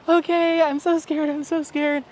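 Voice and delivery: high, small kid voice, mock scared; scared little kid. Kid paired on ride, high mock-scared voice. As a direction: play a nervous little boy before the drop.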